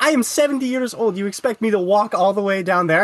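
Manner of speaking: put-on high-pitched Russian-accented complaint; thick Russian accent. Russian grandpa refusing the walk to the aisle, Russian accent. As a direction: imitate an indignant old Russian customer. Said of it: "he's got a thick Russian accent".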